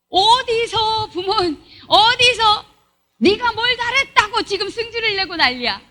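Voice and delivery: high, drawn-out scolding, then a rant; angry parent scolding. Acted. Parent scolds a child who slammed the door, high and furious.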